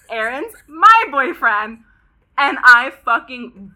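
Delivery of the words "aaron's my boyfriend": insistent retort. Retold or imitated. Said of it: "And she goes".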